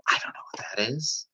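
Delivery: whispered, baffled aside; a hushed, clueless little whisper; confused whisper. Retold or imitated. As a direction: breathe it out as if lost. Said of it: "I'm looking back going".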